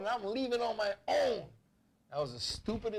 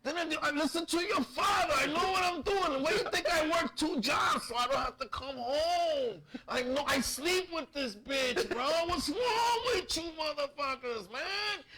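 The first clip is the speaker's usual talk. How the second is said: put-on drunk father; 8 semitones higher than usual. Drunk father berating his four kids, hammed-up shouting rant. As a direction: imitate a worked-up drunk dad ranting at his children. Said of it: "it's because he was drunk".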